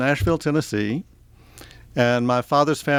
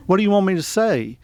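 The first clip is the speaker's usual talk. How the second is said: put-on Southern accent; 7 semitones higher than usual. New kid answering lunch-line teasers, in a mock Southern accent. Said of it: "because I had a Southern accent".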